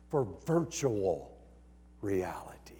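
dropping to a near whisper on the last word; hushed. Real, not acted. Preacher hushing the crowd on a punchline. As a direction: lean in and let it fade to a whisper.

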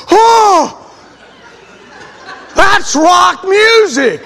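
high, loud, drawn-out shocked cry; scandalized yelp; shocked gasp. Retold or imitated. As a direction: recoil in horror at the records. Upperclassman shocked at rock music.

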